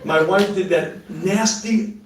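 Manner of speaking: ordinary delivery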